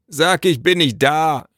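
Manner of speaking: slow call back from indoors. A narrator's reading. Father hiding from the messenger, calling back slowly. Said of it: "rief Elos zurück".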